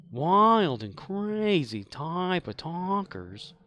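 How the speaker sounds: high, slow mock uptalk; imitating uptalk. Shown as a demonstration. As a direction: stretch it out like an excited TV talker. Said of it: "where they up talk whenever they're at the very end".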